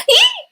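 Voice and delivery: very high loud startled squeak. A narrator's reading.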